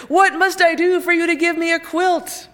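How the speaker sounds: loud, high demanding cry; king shouting. A narrator's reading. The king shouts his demand at the quilt maker. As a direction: bellow the demand in frustration. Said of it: "the king shouted".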